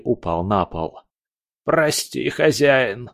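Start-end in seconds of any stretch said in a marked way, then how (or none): 1.6-3.1 hoarse. A narrator's reading. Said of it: "прохрипел он".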